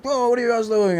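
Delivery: lightly voiced curious Kiwi dude; imitating curious Kiwi. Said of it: "he was like".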